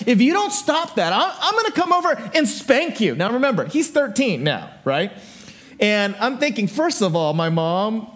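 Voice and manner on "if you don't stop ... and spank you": mock-angry mother, pitched high; mad mom. Mom threatening her teasing son, mock mad. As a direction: scold like a fed-up mom, high voice. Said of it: "my mom got really mad at him".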